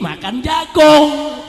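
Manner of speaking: rallying shout. Real, not acted. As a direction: shout it out to rouse the crowd. Rouses crowd for agreement.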